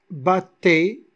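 "battait" split bat-tait, loud; "battait" with a gap between the two Ts; syllables separated. Shown as a demonstration. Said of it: "on a deux consonnes, ils seront séparés".

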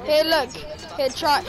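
usual speaking voice

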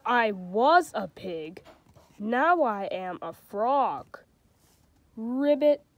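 storybook reading with light character voices; reading to children. A narrator's reading. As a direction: read it like a bedtime picture book. Reads a picture book aloud.

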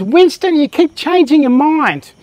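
put-on high nagging wife in a British accent, pitched well up; a mock British accent, scolding Winston. Teller voices the nagging woman at Churchill in a British accent. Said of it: "in a British accent".

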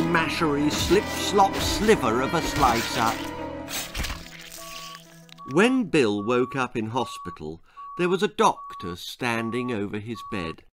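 plain reading voice

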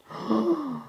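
high, drawn-in mock gasp; playful gasp of outrage. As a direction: gasp in play shock at the baby. Mock shock at baby.

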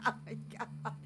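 voiceless, soft words inside a laugh; helpless laughter. Real, not acted. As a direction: squeeze the words out while laughing. Overcome laughing at game's disasters.

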